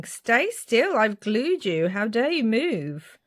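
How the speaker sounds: high, swooping mock outrage; mock scolding. Crafter telling off moving paper pieces, high mock scolding. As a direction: playfully tell off the glued pieces.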